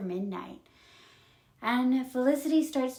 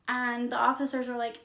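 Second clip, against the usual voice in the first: normal talking voice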